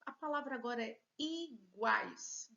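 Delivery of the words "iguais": iguais in two stretched syllables, i-guais. Shown as a demonstration. Teacher presenting a word to students, iguais split in two. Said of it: "Duas sílabas".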